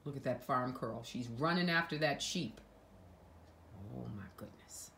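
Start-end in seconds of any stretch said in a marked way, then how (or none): 4.0-4.9 breathless whisper of amazement; whispered aside. Real, not acted. Whispering to the child about the runaway sheep.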